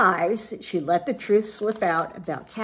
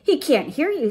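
higher, singsong little sisters; 6 semitones higher, 4 dB louder than usual. A narrator's reading. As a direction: lightly sing it out in a teasing lilt. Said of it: "sang his sisters".

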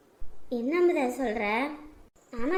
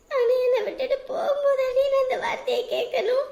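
crying; 7 semitones higher than usual. Acted. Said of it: "எதுக்கு அழுவுற?".

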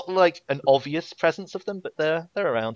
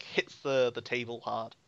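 ordinary talk

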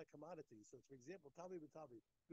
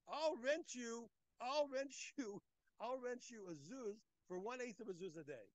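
voicing Rav Chama pitching; 6 semitones higher, 9 dB louder, slower than usual. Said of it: "he said".

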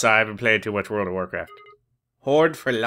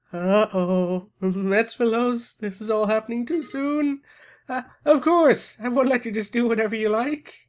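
high goddess voice; 8 semitones higher than usual. Acted. Goddess character.

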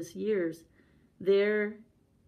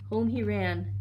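plain reading voice

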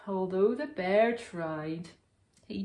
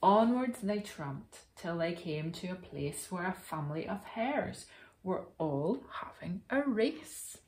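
plain reading voice

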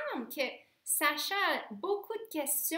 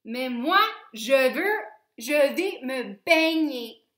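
firm; 9 dB louder than usual. A narrator's reading. Stella insists on swimming.

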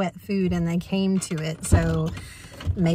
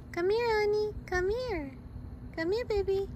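high, soft coaxing call to a scared dog; 10 semitones higher, 4 dB quieter than usual. Real, not acted. Woman coaxing scared stray dog in parking lot, high soft call. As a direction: gently lure the stray dog closer.

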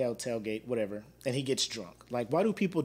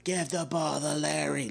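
mock drunk yell; 3 semitones higher, slower than usual. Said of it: "He was just yelling".

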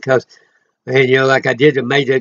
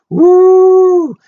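high, loud joyful whoop; a sudden high shout of praise; ecstatic woo; 7 dB louder than usual. Real, not acted. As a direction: cry out in holy joy.

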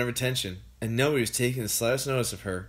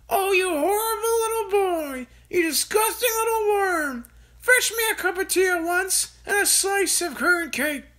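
put-on high, loud, spiteful old-woman rant; furious grandma; 4 dB louder than usual. A narrator's reading. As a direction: spit the insults down at him. Grandma ranting from the rooftop.

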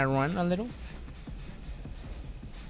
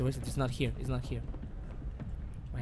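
usual speaking voice

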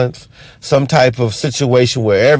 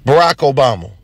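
normal talking voice

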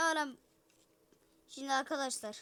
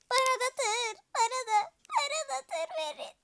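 put-on childish begging; 9 semitones higher, 4 dB louder than usual.

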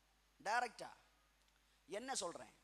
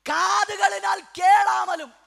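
slow, high, loud proclamation; declaimed scripture; 6 semitones higher, 9 dB louder, slower than usual. Real, not acted. Preacher announcing the point on deaf ears, slow shout. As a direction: stretch it out in a pulpit shout.

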